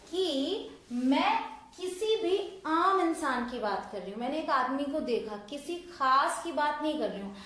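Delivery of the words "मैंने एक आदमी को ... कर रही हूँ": ordinary delivery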